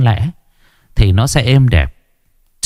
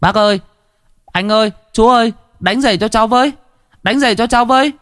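put-on high-pitched children touting for business; little street hawkers calling up high; clamouring shoeshine kids; 13 semitones higher, faster than usual. A narrator's reading. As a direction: chirp out a pleading sales call, very high. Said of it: "Chúng nó nhao nhao lên như lũ chim non mời chào".

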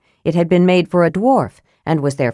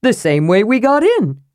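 a slightly different voice, protesting; a lightly voiced kender arguing; 4 semitones higher than usual. A narrator's reading. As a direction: push back like a stubborn kender. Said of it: "Tass argued".

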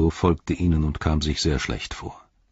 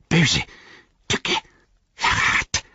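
spiteful creature voice; 7 semitones higher, slower than usual. A narrator's reading. Gollum spitting accusations.

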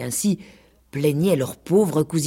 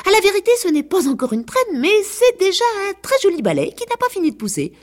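much higher, gentle cat voice; cat pretending to see a crest, much higher; kindly cat; 4 dB louder than usual. A narrator's reading. Cat backpedals.

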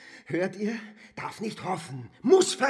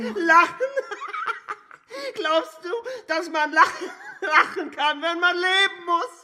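higher, laughing through each word; bitter laughing; 9 semitones higher, 3 dB louder than usual. Acted. Cripple asks whether one can laugh while living, laughing bitterly. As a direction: laugh in despair as you ask.

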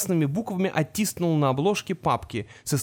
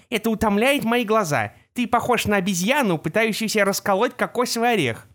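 lightly voiced fussy centaur; 5 semitones higher than usual. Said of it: "сказал тогда Жеребкинс".

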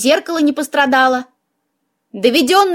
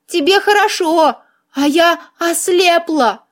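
mildly hammed-up self-pity, voiced rather than whispered; tragic; 5 semitones higher than usual. A narrator's reading. Heroine mourns her sight to the man, tragically. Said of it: "трагически прошептала я".

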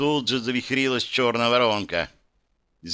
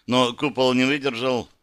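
ordinary delivery

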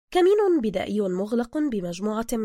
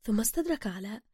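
usual speaking voice

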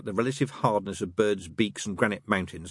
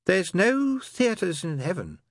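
slow, higher, lightly voiced demon; drawn-out tempting line; Crowley; 12 semitones higher, 3 dB louder, much slower than usual. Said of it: "said Crowley".

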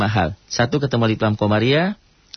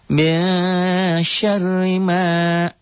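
high, slow chanted recitation; Quran recitation demo; 8 semitones higher, much slower than usual. Shown as a demonstration. Teacher chanting the verse for students, slow and high. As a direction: draw out the Arabic like a reciting teacher. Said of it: "ri-nya kosor".

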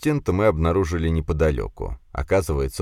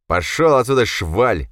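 loud, raised snarl; angry growl; 6 semitones higher, 5 dB louder than usual. A narrator's reading. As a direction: order the scum away angrily. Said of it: "зарычал на меня".

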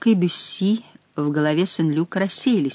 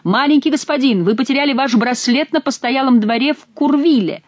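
calling out; 7 semitones higher than usual. A narrator's reading. Stranger still shouting after them.

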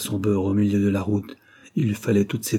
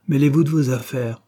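raised, haughty dismissal; 5 semitones higher, 5 dB louder than usual. A narrator's reading. Young man to Charlotte, sending her off, haughty. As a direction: dismiss the intruder, louder and higher. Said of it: "dit le jeune homme avec hauteur".

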